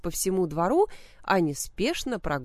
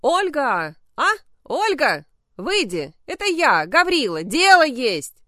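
loud trembling yell, higher; quavering yell; 7 semitones higher, 9 dB louder than usual. A narrator's reading. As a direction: holler at the gate, voice quavering. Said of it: "дребезжащим голосом завопил".

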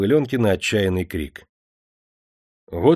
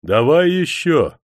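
louder, higher, drawn-out demand; raised gambler's call; eager call; 6 semitones higher, 6 dB louder, slower than usual. A narrator's reading. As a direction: call for another round, stretching it out. Gambler calls for another round.

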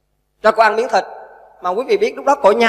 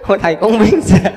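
laughing; 5 dB louder than usual. Real, not acted. Monk laughs at the tale.

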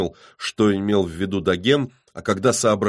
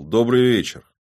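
raised, higher greeting; loud greeting; 5 semitones higher, 6 dB louder than usual. A narrator's reading. Man steps out from the trees to his rivals, loudly. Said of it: "громко объяснил".

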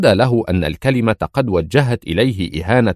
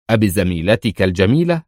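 ordinary delivery, nothing marked

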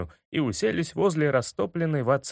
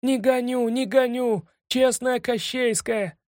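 high squawky protest; high pleading penguin; 7 semitones higher than usual. A narrator's reading. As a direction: insist high and eager. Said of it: "скричал орлик".

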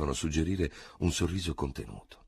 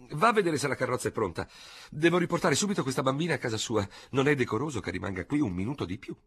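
put-on brisk older lady; 6 semitones higher than usual. A narrator's reading. Léonie briskly taking charge.

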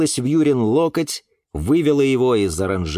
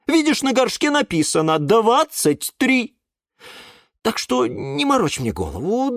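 higher, lightly voiced scolding caretaker; scolding aunt Glasha; 11 semitones higher than usual. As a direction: nag him like a fed-up caretaker. (тётя Глаша ворчит на Юру, слегка сыгранно)

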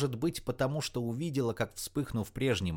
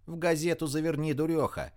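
higher, impatient call to a friend; shouted instruction; 6 semitones higher than usual. A narrator's reading. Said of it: "закричала Рита".

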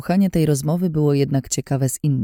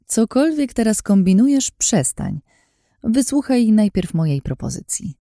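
firm, commanding, a bit higher; 8 semitones higher than usual. A narrator's reading. Lin ordering Anna to stop and listen, firm. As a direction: cut her off firmly. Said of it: "Jej ton był stanowczy".

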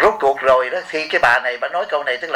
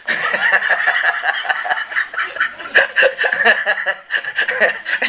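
laughter; 5 semitones higher than usual. Real, not acted. Laughing at own story.

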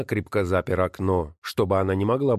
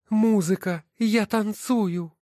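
high, scornful woman's voice; wife's voice; 13 semitones higher, slower than usual. Acted. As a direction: sneer at each title as you sort them. Wife delighted in the machine. (высокий насмешливый голос жены)

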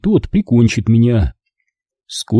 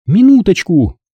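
brisk, higher phone voice; phone answer; 7 semitones higher, 4 dB louder than usual. Acted. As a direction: pick up the call. (мать отвечает на звонок)

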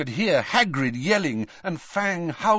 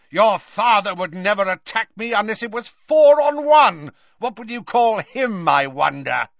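high, forceful shouted taunt; Snape; 7 semitones higher, 6 dB louder than usual. A narrator's reading. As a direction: rage scornfully, easing off at the end. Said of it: "shouted Snape".

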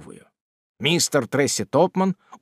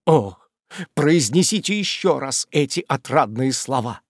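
ordinary delivery, nothing marked

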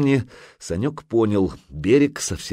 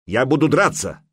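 raised, fighting declaration; shouted defiance; 5 semitones higher, 4 dB louder than usual. A narrator's reading. Грейпюр declares he will fight, shouting. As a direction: cry out, ready for battle. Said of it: "крикнул Грейпюр".

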